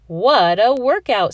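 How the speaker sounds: impressed exclaim. Acted. Teacher impressed by jumping jacks.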